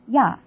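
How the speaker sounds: "ya." as one clear syllable with a y glide before a; "ya." demonstrated as a distinct Chinese particle; semivowel y added. Shown as a demonstration. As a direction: say "ya." set apart, y sound into open a. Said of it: "it's pronounced".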